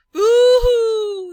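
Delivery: high, loud hooting wail; frightened owl hoot. Acted. Owl fleeing the Gruffalo with a loud hoot.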